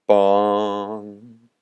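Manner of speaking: long hollow bong; imitated bell toll. Storyteller voicing the bell tolling in the sky, drawn-out bong. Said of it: "A bell tolled in the sky".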